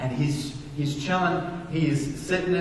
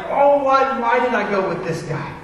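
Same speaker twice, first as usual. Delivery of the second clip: higher, louder frightened outburst; panicked yelp; 6 semitones higher, 4 dB louder than usual. Retold or imitated. Pastor panicking in turbulence, high frightened cry. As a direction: yelp as the plane shakes.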